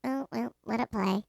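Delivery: soft, careless mock aside; offhand retold reply. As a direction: toss it off quietly. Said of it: "I was just like".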